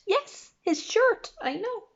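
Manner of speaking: high, slow mock disbelief; storyteller's amazed aside; mock amazement. A narrator's reading. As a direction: play up the surprise for the kids.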